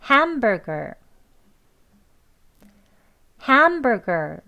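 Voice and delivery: "hamburger" with strong HAM, weakening to "ger"; main stress on HAM. Shown as a demonstration. Teacher giving two models to copy, "hamburger" stressed on HAM. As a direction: punch HAM in "hamburger", relax the last syllable. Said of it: "그 다음엔 약하게".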